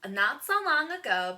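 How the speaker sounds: normal talking voice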